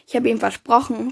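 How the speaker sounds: plain reading voice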